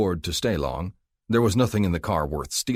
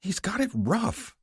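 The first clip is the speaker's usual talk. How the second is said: high, slow whiny plea; whining; slower than usual. A narrator's reading. Rookie whining to his partner about the drunk. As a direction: whine for sympathy. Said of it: "the rookie whined".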